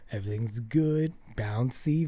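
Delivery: normal talking voice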